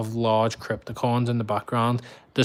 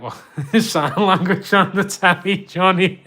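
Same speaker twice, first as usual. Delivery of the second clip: quoting a viewer while laughing, higher and louder; laughing quote; 6 semitones higher, 9 dB louder than usual. Retold or imitated. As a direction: laugh through the viewer's line. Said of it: "he joins in and goes".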